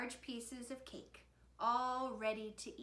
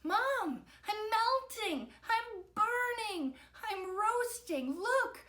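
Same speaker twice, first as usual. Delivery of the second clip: high, loud, drawn-out complaint; panicked wailing; 12 semitones higher, 5 dB louder, slower than usual. A narrator's reading. Victor burning up calls to Mom, panicked wailing. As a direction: wail each phrase slowly in alarm.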